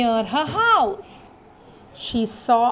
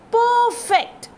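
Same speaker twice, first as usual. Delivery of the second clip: delighted; 8 semitones higher than usual. A narrator's reading. Finding the right bed.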